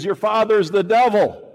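high, loud preaching rebuke; Jesus condemning the religious leaders, loud. Retold or imitated. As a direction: thunder it at the Pharisees.

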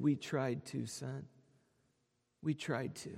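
a choked, tender whisper of regret; tearful father. Retold or imitated. Weeping father answers his newly sighted son, soft and tearful. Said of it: "with tears streaming down his cheek".

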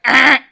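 short, loud mock scream; startled yell. Reacting to the knickers line, startled yell. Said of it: "I was like".

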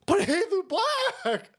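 high, slow drawn-out whine; mock liberal whine. Comic mocking a liberal, slow high whine.

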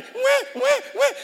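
very high, loud mock baby wail; baby crying imitation. Preacher imitating the baby crying, high loud wail.

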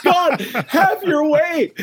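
high, slow, drawn-out call; crying out in prayer. Retold or imitated. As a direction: call out to God over blaring music. Praying out over blaring rock music.

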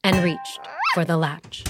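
ordinary delivery, nothing marked

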